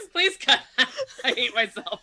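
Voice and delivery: loud, high, laughing through the words; embarrassed laughing. Real, not acted. Podcaster begging for an edit after botching an accent, embarrassed laughing. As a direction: crack up at your own failed attempt.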